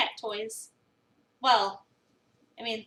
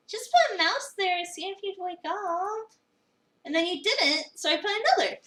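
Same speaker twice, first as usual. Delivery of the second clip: gleeful confession; 7 semitones higher than usual. Acted. Finley gleefully tells Avery about the toys, giddy and high. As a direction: brag about the prank like a kid.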